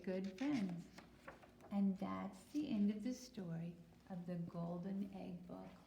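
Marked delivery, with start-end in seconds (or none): none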